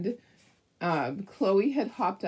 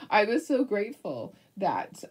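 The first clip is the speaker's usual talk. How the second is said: ordinary talk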